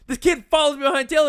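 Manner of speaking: ordinary talk, nothing marked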